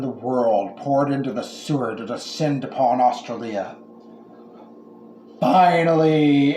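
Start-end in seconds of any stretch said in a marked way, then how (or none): 5.4-6.6 many voices in unison. Acted. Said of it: "everyone in the world said in unison".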